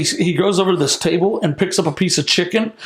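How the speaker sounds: usual speaking voice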